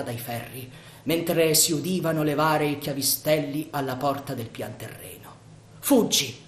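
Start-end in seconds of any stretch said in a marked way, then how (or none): 5.8-6.4 loud urgent cry to flee; shouted warning. Acted. Yanez shouts to Sandokan to escape, loud warning. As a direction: yell it from below the pergola. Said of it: "Gridò Yanez".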